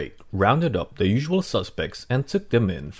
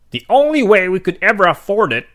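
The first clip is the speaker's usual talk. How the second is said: higher, louder dad voice, half joking; dad joking to mom about the teeth's cost, louder higher; 10 semitones higher, 10 dB louder, faster than usual. Acted. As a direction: play dad cracking a grim joke. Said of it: "he joked to Mom".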